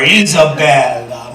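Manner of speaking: Isabella drawn out slowly and tenderly; doting. Real, not acted. Grandfather adores his five-year-old granddaughter, doting drawn-out Isabella. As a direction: savor the name Isabella, stretching it out.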